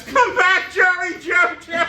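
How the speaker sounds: high, loud cartoon holler; high-pitched cartoon yell, laughing at the end; Barney from The Simpsons. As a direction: shout it after someone running away, name drawn out. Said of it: "and he goes".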